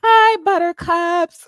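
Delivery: high, slow sugary hi in a put-on voice; sweet high greeting. Imitating the host turning sweet again. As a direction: coo it sweetly and slowly. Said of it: "Then it's".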